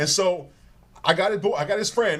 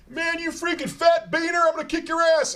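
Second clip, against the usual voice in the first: mock taunt; 10 semitones higher than usual. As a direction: imitate a loudmouth picking a fight, pitched way up. Retold taunt from a rival.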